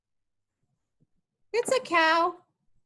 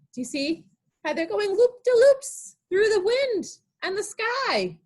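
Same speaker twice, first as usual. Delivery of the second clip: ordinary delivery, nothing marked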